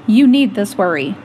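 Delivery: put-on a loud, firm answer to the child; emphatic declaration. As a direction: tell them firmly and loudly.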